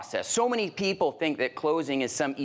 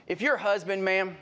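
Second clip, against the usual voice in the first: ordinary delivery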